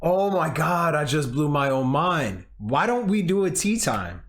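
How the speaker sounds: like you just blew your own mind; excited. Real, not acted. Hyping up a tea time idea to her friend, excited.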